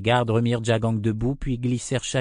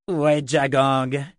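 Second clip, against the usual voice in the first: slow, higher shouted question; shouting; 4 semitones higher, 4 dB louder, slower than usual. A narrator's reading. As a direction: call out over the battle noise. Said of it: "cria-t-il".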